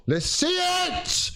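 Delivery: high, slow yell at the team; frustrated shout. Real, not acted. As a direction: scream it out in frustration. Frustrated fan screams at team.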